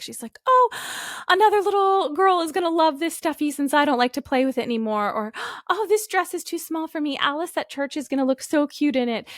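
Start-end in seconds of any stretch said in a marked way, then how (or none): 0.5-5.1 high, gushing child imitation; pitched-up mock-child excitement; enthusiastic little girl. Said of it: "she's so enthusiastic". 5.7-9.3 high-pitched imitation of an eager child; pitched-up childlike enthusiasm; enthusiastic little girl. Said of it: "she's so enthusiastic".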